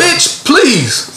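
high scoffing brush-off; dismissive retort. Real, not acted. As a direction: toss it off high with contempt.

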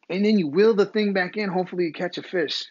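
normal talking voice

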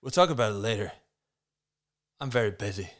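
lightly put-on American accent; amateur American accent, hammy script read. Mark putting Lisa off on the phone, mock American accent. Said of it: "I went for an American accent".

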